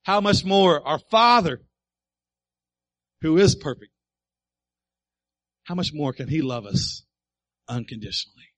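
loud, high preaching peak; preaching at full force. Real, not acted. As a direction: preach it out with power. Preaching climax.

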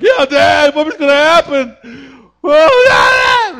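high, loud crying wail; panicking kids. Pretend. Kids panicking over dad's lost job, wailing high. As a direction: wail like a scared child.

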